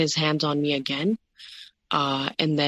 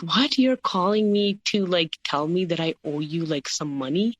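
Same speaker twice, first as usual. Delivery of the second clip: retold indignant disbelief; 2 semitones higher than usual. Wife to ex-husband demanding money back, indignantly incredulous. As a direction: act out being stunned by the demand.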